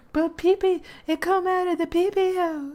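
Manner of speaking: put-on high, quick childish voice; silly baby talk. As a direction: babble it like a toddler. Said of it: "Don't say it like that".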